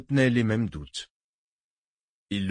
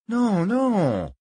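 high, long-stretched no; drawn-out objection; 10 semitones higher, 4 dB louder than usual. Acted. Barent to his panicking human pawn, objecting. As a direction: chide him with a sweeping, falling no.

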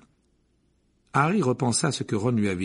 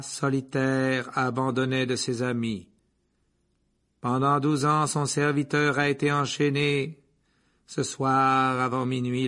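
slow, ringing oracle voice; hard trance voice; 4 semitones higher, 3 dB louder, slower than usual. A narrator's reading. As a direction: deliver the prophecy as if possessed. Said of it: "de cette même voix dure".

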